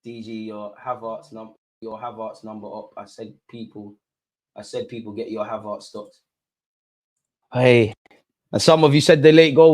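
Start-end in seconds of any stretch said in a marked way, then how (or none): 0.0-6.2 nervous fan murmuring to chat; tense murmur. Real, not acted. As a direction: mutter it under your breath. Muttering under tension, very soft.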